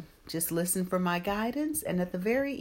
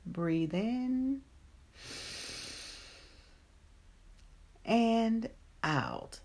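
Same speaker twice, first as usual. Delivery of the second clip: guided meditation; 5 dB quieter than usual. Acted. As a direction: ease them gently into calm.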